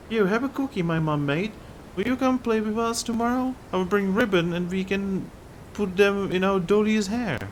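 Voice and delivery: put-on very high, sweet child voice; high-pitched childlike chatter; little girl. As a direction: play a small girl inviting a friend to play dolls.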